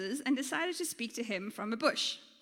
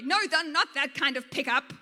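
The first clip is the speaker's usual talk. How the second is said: loud, emphatic storybook correction; projected, comically exasperated bush; said loudly; 3 semitones higher, 8 dB louder than usual. A narrator's reading. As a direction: correct him at full volume. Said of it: "The bush said loudly".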